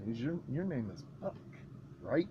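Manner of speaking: low menacing quick mutter; film villain line. Pretend. Quoting the movie back to friend, low and soft.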